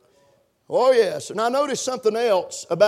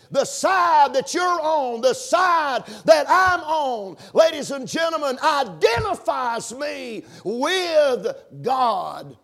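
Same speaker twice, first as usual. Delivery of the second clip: slow, high, ringing pulpit shout; raised preacher's voice, drawn out and emphatic; forceful preaching; 4 semitones higher, slower than usual. Real, not acted. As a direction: drive every phrase home with long stretched stresses. Preacher drives home his main point.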